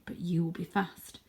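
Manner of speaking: ordinary delivery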